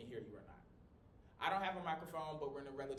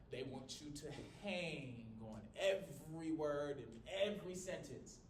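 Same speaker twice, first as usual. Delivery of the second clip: slow preacher oratory, hanging on words; Martin Luther King; slower than usual. Acted. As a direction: draw words out like a church orator. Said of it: "Very M L K coded".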